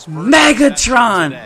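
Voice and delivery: name stretched out slowly in celebration; drawn-out triumphant call; triumphant shout. Real, not acted. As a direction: call out the name long and proud. Celebrates the catch.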